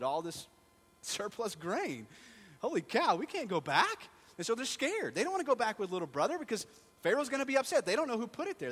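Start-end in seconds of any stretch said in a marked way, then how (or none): 2.6-8.7 high, loud hammed-up panic; high loud mock alarm. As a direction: play the brothers' alarm big. Retelling the brothers' panic.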